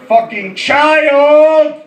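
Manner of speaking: put-on loud, drawn-out yell on CHILD; comic bellow of disgust; exasperated outburst. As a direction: erupt and stretch out the last word. Comic outburst at monster kid.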